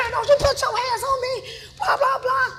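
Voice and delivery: loud, high-pitched scolding shout; high angry yelling. Retold or imitated. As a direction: yell while hitting back. Retells yelling while fighting back.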